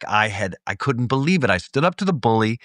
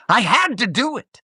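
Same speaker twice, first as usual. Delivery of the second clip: much higher, pleading whine; plaintive; 13 semitones higher than usual. Retold or imitated. Bully after the fight, mock plaintive high plea. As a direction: beg him to understand, mock-pitiful. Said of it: "looking at me plaintively like".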